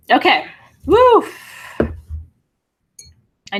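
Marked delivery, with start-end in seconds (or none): 0.9-1.2 high, loud whoop; a real whoop of release. Real, not acted. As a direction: let out a big whoop to shake it off. Shaking off the tears.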